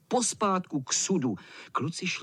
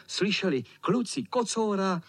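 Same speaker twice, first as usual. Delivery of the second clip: ordinary delivery, nothing marked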